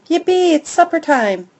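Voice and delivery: high, loud happy cheer; bouncing with glee; excited yelp. A narrator's reading. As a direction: burst out with puppy joy. Dog excited about supper.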